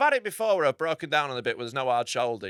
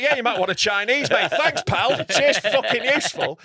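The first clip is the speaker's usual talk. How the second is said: higher, sarcastic cheeriness; mock helpline advice; 6 semitones higher, 4 dB louder than usual. Caller mocking the advice line, sarcastic. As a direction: sneer as you thank them.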